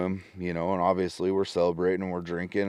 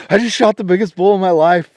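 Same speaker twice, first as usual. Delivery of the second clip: louder and higher, laughing through the words; laughing excited retelling; 12 semitones higher, 13 dB louder than usual. Retold or imitated. Hunter repeating his big news to wife, giddy laughing. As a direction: blurt it out again, giddy and laughing.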